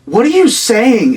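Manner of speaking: drawn-out confused question, like she can't follow; imitating a baffled girlfriend. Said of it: "She goes".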